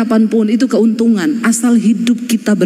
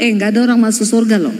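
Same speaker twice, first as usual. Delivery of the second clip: mock scolding; faster than usual. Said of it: "istrimu di rumah marah-marah ngomong".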